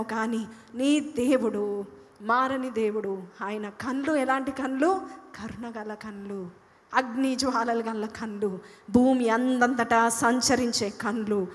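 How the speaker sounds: slow, weighty sermon phrases; slow emphatic preaching. Real, not acted. Preacher slowly extols God's eyes, weighty. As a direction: lay each phrase down slowly.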